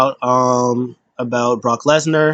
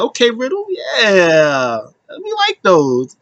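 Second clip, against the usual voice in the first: high mock humoring; 7 semitones higher, slower than usual. Humoring Riddle, retold.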